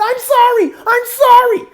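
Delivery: very high, loud squealed apology; hammed-up high-pitched begging. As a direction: plead in a piercing falsetto. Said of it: "the dude high pitched said".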